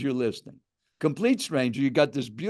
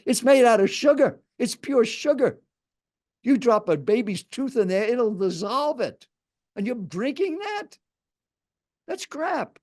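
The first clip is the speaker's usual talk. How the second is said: high, insistent retold lecture; aggressive; 8 semitones higher than usual. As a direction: imitate an appalled stranger laying down the verdict. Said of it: "kind of an aggressive tone".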